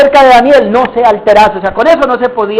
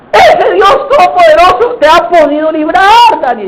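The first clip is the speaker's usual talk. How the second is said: sad calling out; 7 semitones higher than usual. Acted. King asking if God could save Daniel, sorrowful shout. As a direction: call down into the pit, desperate for an answer. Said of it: "A gritos, pero con voz triste".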